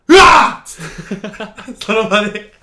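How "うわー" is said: loud startled yell; retold yell. Man recalling waking at the airport, a loud startled cry. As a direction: shout out in shock. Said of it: "叫んだもんな".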